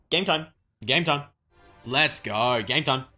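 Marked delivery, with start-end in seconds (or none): none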